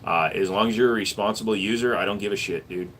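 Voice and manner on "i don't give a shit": normal talking voice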